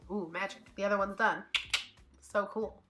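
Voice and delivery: ordinary talk, nothing marked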